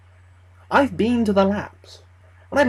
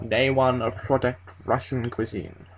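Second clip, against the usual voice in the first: lower, softer throaty voice of a recorded scientist; a familiar throaty voice off an old tape; throaty deep tape voice; 7 semitones lower, 5 dB quieter than usual. Acted. Scientist's voice on the found tape, lower and throaty. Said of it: "The throatiness, the depth and pitch".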